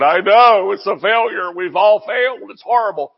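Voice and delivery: mock grieving. Preacher mimicking a grief-stricken Jesus he rejects, mock grieving. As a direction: wail it in mock despair. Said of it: "He's not standing here grieving".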